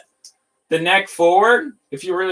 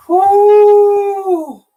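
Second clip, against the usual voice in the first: high, loud joyful whoop; excited whoop; 8 dB louder than usual. Real, not acted. Builder cheering at his new part, high loud whoop. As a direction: let out a thrilled whoop. Thrilled at finding the piece.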